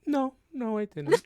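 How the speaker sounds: high, loud laughing denial; laughing protest. Acted.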